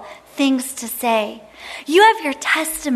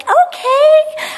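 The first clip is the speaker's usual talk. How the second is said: very high, louder sing-song okay; cheery kid; 14 semitones higher, 6 dB louder than usual. Retold or imitated. Young kid answering mom, bright and high. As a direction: chirp it like a compliant little kid. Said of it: "It's like".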